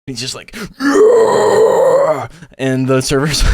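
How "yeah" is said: loud, high imitated battle cry; long mock war yell; barbarian yell. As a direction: roar like a barbarian charging with battle axes. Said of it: "just yells at the top of his lungs, like, barbarian style".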